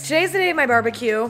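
ordinary talk, nothing marked

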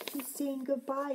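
plain reading voice

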